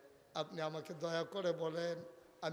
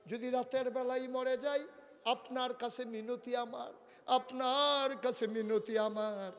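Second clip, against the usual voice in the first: high, raised pleading as Fatema; impassioned entreaty; 8 semitones higher, 6 dB louder than usual. Acted. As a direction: beseech the husband, drawing out the repeat. Preacher voices Fatema's entreaty. (উঁচু গলায় কাতর মিনতি)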